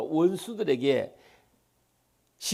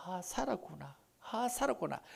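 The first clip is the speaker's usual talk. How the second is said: soft, breathy relief rising higher; relieved sigh; 4 semitones higher, 11 dB quieter than usual. Retold or imitated. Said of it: "온 가족이". (수술 후 안도하는 가족, 나직한 안도)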